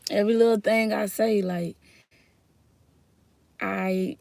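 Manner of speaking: ordinary talk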